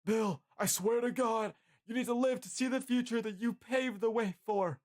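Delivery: high, strained yell; Jeff screaming. A narrator's reading. Jeff urges dying Bill to live, screaming. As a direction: yell desperately, pitched right up. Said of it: "Jeff screams at you".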